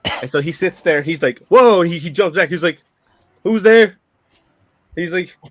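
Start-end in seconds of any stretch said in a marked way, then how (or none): 1.5-1.8 loud, high yelp of surprise; startled. Acted. Game master as Jim hearing a ghost, loud startled cry. 3.4-4.0 high, loud startled challenge; alarmed. Acted. Game master as Jim hearing a ghost, loud and alarmed.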